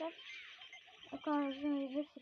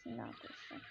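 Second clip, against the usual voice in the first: hushed whisper; 9 dB quieter than usual. Real, not acted. Scared gamer whispers to viewers during danger. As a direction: whisper it tensely.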